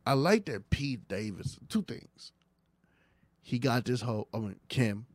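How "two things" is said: whisper. Acted. Secret aside about Pete.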